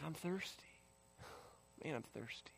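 very soft, inward admission; barely voiced confession of need; hushed realisation. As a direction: murmur it as if to yourself. Voices guards quietly realising their need.

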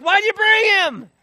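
high, loud, drawn-out shout; acted indignant outburst at full volume; outraged demand. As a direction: yell it like a furious accuser. Acts out priests' angry demand.

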